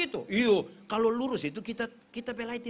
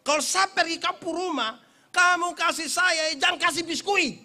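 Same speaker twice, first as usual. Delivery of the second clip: mock instruction; 9 semitones higher, 7 dB louder than usual. Preacher telling village hosts not to serve biscuits, playful higher command. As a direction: playfully order the hosts around. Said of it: "Saya bilang begini".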